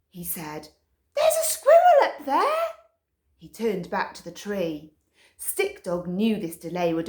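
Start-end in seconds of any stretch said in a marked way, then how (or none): 1.1-2.6 very high, loud excited pup voice; high loud excited Poo Poo. A narrator's reading. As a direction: squeal it like a dog who spotted prey. Poo Poo fixated on squirrel.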